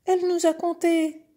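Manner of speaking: very high, loud calf tattling; complaining calf. Acted. Calf telling father about the little goat, high indignant voice.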